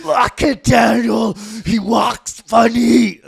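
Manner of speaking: loud, high, very slow holler; hammed-up dad shout. Mock dad mocking passers-by to son, slow hollering. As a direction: stretch out every word at the top of your voice. Hams up dad's pointing at strangers.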